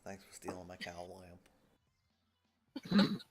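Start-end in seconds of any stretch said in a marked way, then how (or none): 2.9-3.2 Homestar Runner voice. Pretend. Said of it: "I was doing the Homestar Runner voice".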